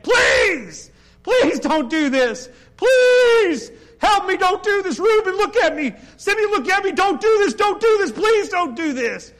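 screamed high, loud pleading to his brothers; desperate begging. Acted. Joseph begging his brothers not to sell him, loud desperate pleading. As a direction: beg for your life, voice cracking high. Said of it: "he begged".

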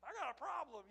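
high, loud mock complaint; loud, high teasing grumble; granddad's joke voice. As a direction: play the prankster grandpa, nose-plugged joke. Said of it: "shove it up his nose and say".

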